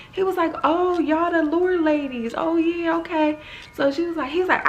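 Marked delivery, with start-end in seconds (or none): 0.6-3.4 high, friendly dawning recognition; lightly voiced recognition. Retelling a scene, the man greets the stranded dancers in a light, high voice. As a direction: play a man realizing who they are.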